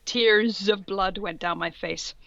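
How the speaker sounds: ordinary talk, nothing marked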